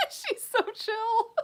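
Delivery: high, slow giggly coo; laughing. Real, not acted. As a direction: laugh through the words. Laughing while cooing.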